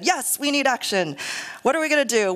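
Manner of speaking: pumped-up retold agreement; fired up. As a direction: answer with sudden rising enthusiasm. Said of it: "So we were all fired up with them".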